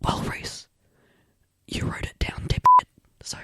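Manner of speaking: mock ASMR whisper. Co-host answers Reese's ASMR question, whispering. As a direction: whisper the jab back, unhurried.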